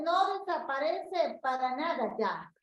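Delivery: ordinary delivery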